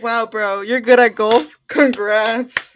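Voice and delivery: loud, mock-impressed congrats; exaggerated fake cheering; loud sarcastic praise. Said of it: "It's like".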